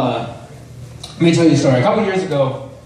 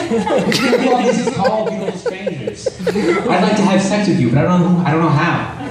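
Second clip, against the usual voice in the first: laughing. Pretend. Acting out shy self among women.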